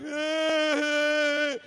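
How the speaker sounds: high mock wail; light imitation of crying. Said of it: "she's crying, like".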